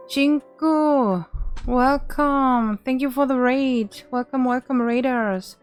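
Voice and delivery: high, bright excited greeting; cute anime streamer. Real, not acted. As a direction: welcome them with big energy.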